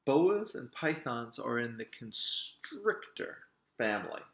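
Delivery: plain reading voice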